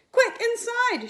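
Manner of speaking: high, loud call to get inside; urgent shout. Acted. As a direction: hurry everyone out of the rain. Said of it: "shouted Missus Thomas".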